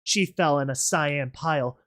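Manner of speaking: usual speaking voice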